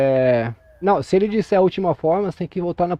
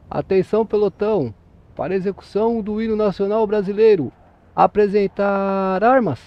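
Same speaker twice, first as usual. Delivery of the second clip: military drill command; 3 semitones higher, 4 dB louder than usual. Shown as a demonstration. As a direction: call it like a drill sergeant, stretching the final word. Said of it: "vai falar assim".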